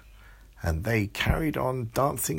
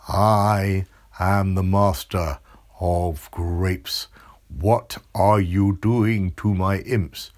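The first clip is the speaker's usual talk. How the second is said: slow, loud, menacing monster voice; booming monster; 7 dB louder, much slower than usual. Acted. Grape master bellowing at Amelia, slow and loud. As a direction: boom it out like a giant grape monster.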